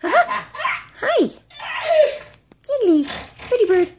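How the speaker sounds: squeaky pet talk, laughing, slow; parrot voice. Real, not acted. Cooing at pet bird, laughing.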